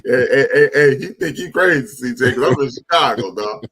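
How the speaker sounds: loud, high-pitched joking call-out. Real, not acted. Calling out C J for thinking he's crazy, loud call-out. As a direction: holler it over to a friend.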